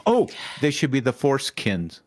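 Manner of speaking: ordinary delivery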